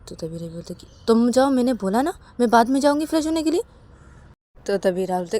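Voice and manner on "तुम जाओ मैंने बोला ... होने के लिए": insistent wife. Acted.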